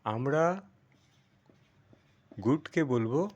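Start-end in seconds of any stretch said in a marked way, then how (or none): none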